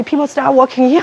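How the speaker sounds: ordinary talk, nothing marked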